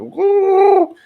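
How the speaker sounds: loud, very high "woo"; imitated high whoop. Retelling what she does sitting there, high mock whoop.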